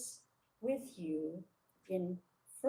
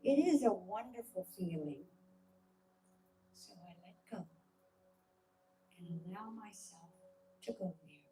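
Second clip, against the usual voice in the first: guided meditation. Acted. Leader talking listeners through a calming visualisation, guided meditation. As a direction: guide listeners into calm, phrase by measured phrase.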